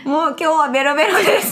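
tipsy, laughing, fast and loud. Real, not acted.

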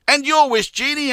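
high, curious husband question; husband asking. Acted. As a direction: ask the genie brightly. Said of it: "asked the husband".

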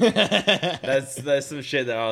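words breaking into laughter; laughing. Real, not acted. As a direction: laugh through the line. Laughing at the story.

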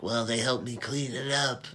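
a light put-on Chick voice. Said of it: "It's my Chick voice".

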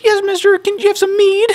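put-on very high squeaky child voice; little kid voice.

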